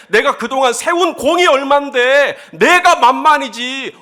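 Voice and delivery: high, loud indignant protest; irritated Saul. Acted. Preacher voicing a jealous king's grievance over David, loudly irritated. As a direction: rant with exasperation, stretching the grievances. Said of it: "사울의 이 짜증을 내는".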